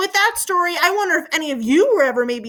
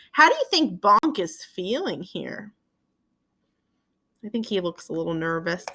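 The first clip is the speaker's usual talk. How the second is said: ordinary delivery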